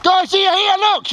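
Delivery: high urgent shouts to squad. Real, not acted. Calling squad in battle.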